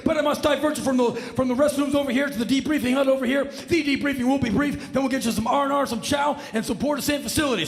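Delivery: high, loud, fast military cheer; gung-ho airman. Acted. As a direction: pump up the arrivals.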